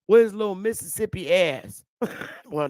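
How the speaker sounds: normal talking voice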